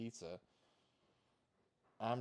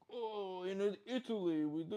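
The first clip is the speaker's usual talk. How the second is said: higher mock Italian accent, put on; 8 semitones higher than usual. Imagined Cambodian guy faking Italian, mock Italian accent. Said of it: "Some Cambodian guy going".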